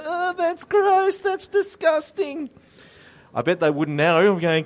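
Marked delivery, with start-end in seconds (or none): 0.1-2.5 high, slow mocking schoolkid "ew"; mocking disgust. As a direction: sneer it like a playground kid. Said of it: "They'd all laugh at me and go".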